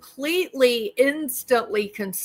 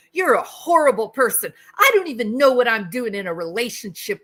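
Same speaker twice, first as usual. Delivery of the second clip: indignant outburst. Retold or imitated. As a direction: play a cheater blowing up to dodge the question. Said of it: "They go".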